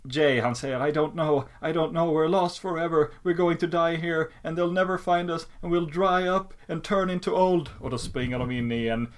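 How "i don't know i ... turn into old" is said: higher-pitched, run-on mock panic of a kid; panicking child. Lost boy fearing they will die here, panicked whine. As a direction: spiral into childish doom, voice raised.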